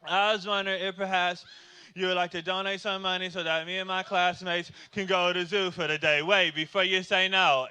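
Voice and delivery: monotone memorized sales pitch; rote, unvarying schoolkid delivery; kid reciting script. Acted. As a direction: recite the fundraiser pitch like a child on autopilot. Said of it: "He was going through his script".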